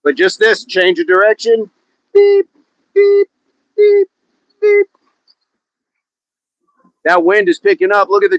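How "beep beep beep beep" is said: high, even imitated beeps; reversing beeper. Changing direction like a parking test, high reversing beeps. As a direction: beep like a truck backing up, evenly spaced.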